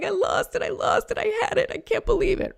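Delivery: put-on high-pitched wail of a devastated little kid; hammed-up tearful whine; mock crying child. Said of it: "And they're crying and they're like".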